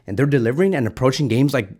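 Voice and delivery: ordinary delivery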